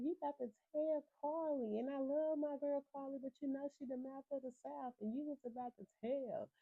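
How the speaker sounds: high-pitched scolding retelling; imitated telling-off. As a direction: imitate her getting on his case, pitched well up. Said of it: "Sierra done got on Zay ass".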